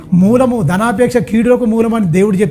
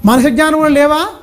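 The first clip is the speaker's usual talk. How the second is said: higher, raised preaching read; preaching scripture; 3 semitones higher than usual. Acted. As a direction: call it out to the congregation.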